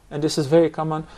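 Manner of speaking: normal talking voice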